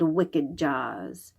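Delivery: ordinary delivery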